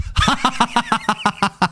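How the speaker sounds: high staccato cackle; long run of quick, high-pitched laughs. Real, not acted. Laughing at his own joke.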